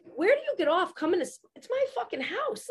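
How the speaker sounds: high-pitched indignant outburst, not shouted; retold outrage. Furious homeowner retelling a run-in at the house, indignant. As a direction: replay losing it, voice shooting up. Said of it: "I was losing my mind".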